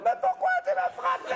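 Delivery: very high mock shriek of the girlfriend; outraged girlfriend. La copine frappée crie, voix très aiguë et outrée. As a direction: squeal it like the hurt girlfriend.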